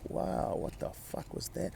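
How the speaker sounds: breathy, slow whispered disbelief; hushed re-enacted astonishment; stunned whisper. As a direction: whisper it slowly, reeling in shock. Retells shock after letting go.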